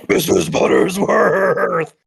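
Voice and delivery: slow, strained raspy politician; RFK Junior voice. Acted. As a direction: drag the name out slowly and strained. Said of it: "The skeleton talks like R F K Junior".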